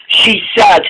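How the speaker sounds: plain reading voice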